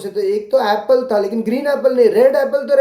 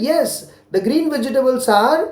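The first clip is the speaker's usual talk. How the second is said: slow model answer; 2 semitones higher, much slower than usual. Shown as a demonstration. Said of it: "कौन से कौन से fruits".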